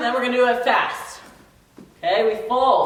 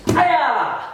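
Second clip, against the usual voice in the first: high, sharp martial arts yell; kiai shout; 6 semitones higher, 3 dB louder than usual. Shown as a demonstration. Instructor leading the class with a high kiai shout. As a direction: snap out the hi-ya on the strike.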